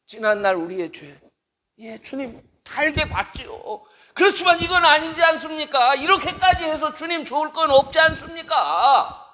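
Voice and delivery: high, loud anguished plea to God; impassioned lament prayer. Acted. The preacher laments to God over the church, loud anguished plea. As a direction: cry out to God about the church.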